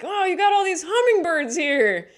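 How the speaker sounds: high, loud, delighted remark; squealing with delight; excited chatter. Retold or imitated. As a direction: gush over the birds. Acted quote to neighbor.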